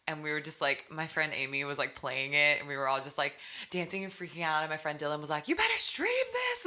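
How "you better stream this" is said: very high mock yell; imitated yelling. Artist imitating a friend promoting her new single, yelling. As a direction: re-enact a friend's squealed order. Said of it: "I was, like, yelling".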